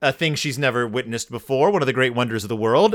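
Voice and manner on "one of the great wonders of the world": normal talking voice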